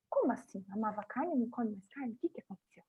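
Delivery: mock an intrigued listener, softly; hushed, curious questions; intrigued curiosity.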